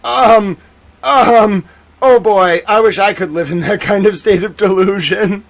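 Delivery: sarcastic. Retold or imitated. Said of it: "I was like".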